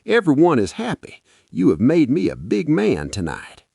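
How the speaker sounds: high, loud, beaming praise; proud husband. Acted. Husband boasting to his tired wife, proud. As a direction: puff up with pride. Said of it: "husband praising wife".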